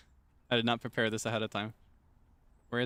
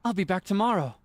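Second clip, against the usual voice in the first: put-on old-Hollywood transatlantic farewell, higher and louder; old movie transatlantic accent; 3 semitones higher, 3 dB louder than usual. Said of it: "I feel like I'm in an old movie".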